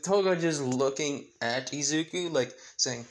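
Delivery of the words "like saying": ordinary delivery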